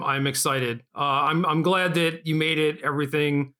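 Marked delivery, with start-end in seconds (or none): none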